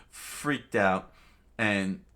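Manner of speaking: usual speaking voice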